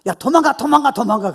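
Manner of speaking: loud, high urging, easing off at the end; urgent warning. Acted. People round David urging him to flee, urgent warning. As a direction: press them to run, loudly. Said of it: "주위 있는 사람이 다윗이 너무 비참하니까".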